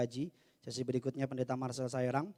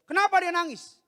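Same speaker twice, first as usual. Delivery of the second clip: loud, high demand; alarmed angry shout; 16 dB louder than usual. Retold or imitated. Father confronting mother over the child's crying, angry shout. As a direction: burst out shouting as an upset father. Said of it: "Mamanya ketakutan".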